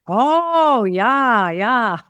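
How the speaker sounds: "Oh, yeah, yeah" sung out high and loud with a big falling melody; musical oh yeah. Retold or imitated. Indonesians showing the musical way to say yeah. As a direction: imitate the locals' lilting yeah. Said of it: "enough musicality to it".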